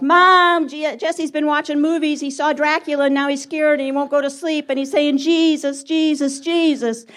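high, tattling yell for Mom; Wayne. Acted. Brother telling on Jesse at bedtime, yelled tattling. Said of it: "So Wayne yells".

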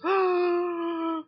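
sharp high gasp of alarm; a startled intake of breath; mock gasp. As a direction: gasp as if losing something precious. Mock panic over giving the ring.

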